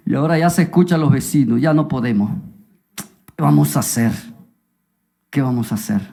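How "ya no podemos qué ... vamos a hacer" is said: low, subdued despair; understated helplessness; acted resignation. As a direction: say it low, out of options. Parents give up in despair.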